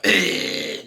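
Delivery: loud harsh throat rasp; irritated throat scrape. Shown as a demonstration. Coach showing what bubbling is not, harsh throat scrape. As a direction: scrape the throat roughly, as a bad example. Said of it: "it's not a".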